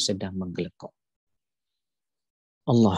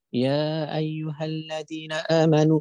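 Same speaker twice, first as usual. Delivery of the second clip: slow, higher, voice near to tears; tearful recitation; 6 semitones higher than usual. Shown as a demonstration. Preacher shows how the pure-hearted recite, tearfully. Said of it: "bunyinya begini".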